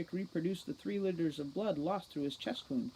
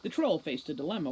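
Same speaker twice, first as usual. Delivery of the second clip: usual speaking voice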